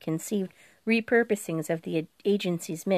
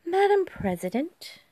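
high, lilting and spacey; sing-songy voice; 11 semitones higher than usual. A narrator's reading. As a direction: sing it out as if half elsewhere. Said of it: "talking in her sing-songy voice".

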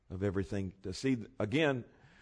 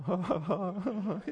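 higher, airy mock laugh of his mentor; imitated laugh; 8 semitones higher, 4 dB quieter than usual. Retelling how the pastor laughed at him, mock laughter. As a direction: playfully imitate the pastor's chuckle. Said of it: "He was laughing".

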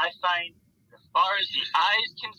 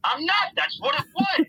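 high, raised, insistent denial; high protest; 12 semitones higher than usual. Retold or imitated. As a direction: protest it, pitched way up. Retold self protesting.

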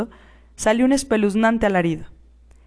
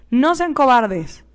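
loud, slow scolding; 4 semitones higher, 4 dB louder, much slower than usual. A narrator's reading. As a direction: scold them for cowardice. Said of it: "nos regañó".

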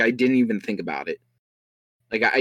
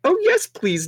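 put-on very high, girlish squeal; high-pitched princess glee; delighted squeal. A narrator's reading. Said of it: "Daisy squealed with delight".

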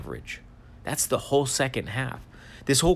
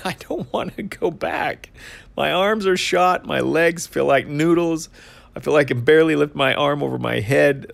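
higher-pitched, hammed-up whine of exhaustion; mock complaint; 4 semitones higher, 5 dB louder than usual. Imagined gym-goer balking at another workout, mock complaining. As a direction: play up being too sore to go back.

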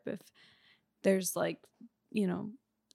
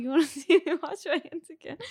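teasing joke; 7 semitones higher than usual. Retold or imitated. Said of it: "I'm just kidding".

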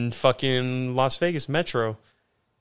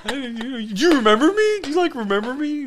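high, bright friendly chatter with a laugh; suddenly all nice; 11 semitones higher than usual. Retold or imitated. Drunk guy turning friendly with the cop, high and laughing. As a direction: switch to gushing buddy mode. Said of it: "Then I started getting all nice".

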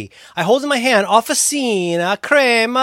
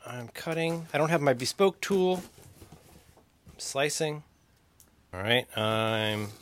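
much softer unboxing voice; hushed narration; 5 semitones lower, 13 dB quieter, slower than usual. Real, not acted. Narrating the unboxing on air, hushed. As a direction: drop to a near murmur as you cut. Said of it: "is that your A S M R".

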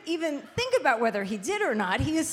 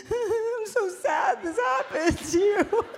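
fake crying; 10 semitones higher, slower than usual. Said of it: "I was trying to pretend I was crying".

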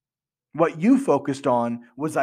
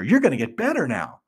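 ordinary talk, nothing marked

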